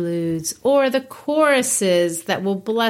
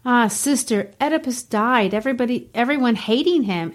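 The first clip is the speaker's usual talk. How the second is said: reading Ismene; 4 semitones higher than usual. Acted. Teacher reads Ismene aloud.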